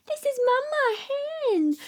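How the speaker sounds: ordinary talk, nothing marked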